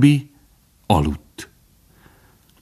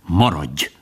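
commanding; 2 semitones lower than usual. A narrator's reading. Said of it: "de az öreg parancsolt".

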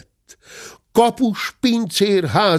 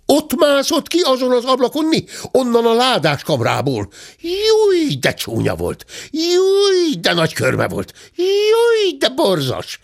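high-pitched squeals between remarks; agitated maid; 14 semitones higher than usual. A narrator's reading. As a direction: yelp "júj" very high, then exclaim. Maid tells of seeing thief.

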